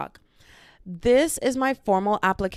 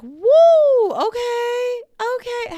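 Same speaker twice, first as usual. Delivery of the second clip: high, loud party "woo"; re-enacted poolside whooping; drunk party whoop; 10 dB louder, much slower than usual. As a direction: whoop it up, high-pitched and tipsy. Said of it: "I actually was out there like".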